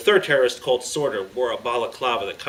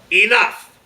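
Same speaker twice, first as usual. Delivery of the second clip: high, loud one-word shout; sharp, forceful leader's shout; yelled command; 9 semitones higher, 10 dB louder than usual. A narrator's reading. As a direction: yell to halt everyone. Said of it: "The leader then yelled".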